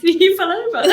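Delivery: laughing. Retold or imitated. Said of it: "That was me".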